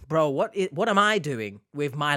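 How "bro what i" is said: normal talking voice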